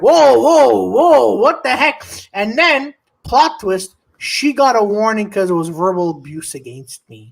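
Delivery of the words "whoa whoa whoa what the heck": drawn-out, mildly alarmed whoas; slow mock-shocked intervention; startled manager. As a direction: imitate a boss stepping in to halt a fight. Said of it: "the manager's like".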